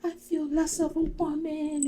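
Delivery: very high, whiny imitation of woe; mock distress. Shayla fretting to her mama, mock distraught. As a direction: lament in a put-on high voice. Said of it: "all this crazy stuff".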